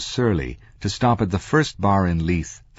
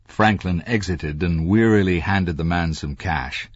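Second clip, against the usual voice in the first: usual speaking voice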